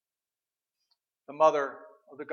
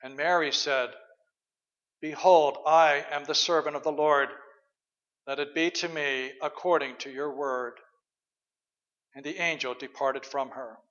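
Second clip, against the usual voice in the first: measured, reverent reading of the Gospel; scripture reading; 3 semitones lower than usual. Acted. Pastor reading Luke to the congregation, reverent scripture reading.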